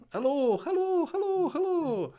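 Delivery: high and slow, laughing through it; a goofy squeaky hello; squeaky mic test voice. Pretend. Silly mic test after the fix.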